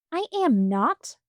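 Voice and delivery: a touch of hot denial, slow; girl denying she is scared, mildly heated; hotly. A narrator's reading. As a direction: snap back, stung. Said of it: "said Kit hotly".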